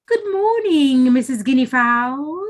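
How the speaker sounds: butter her up with a honeyed greeting; sly trickster sweetening his tone; sugary voice. A narrator's reading. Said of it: "in his sugary voice".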